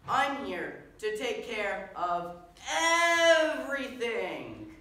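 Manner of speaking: very loud, slow, high, with a hugely stretched "everything"; over-emphatic superhero. Acted. Superhero repeating his boast because nobody was impressed, over-emphatic. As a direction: spell it out grandly for an unimpressed crowd. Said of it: "maybe I wasn't clear enough".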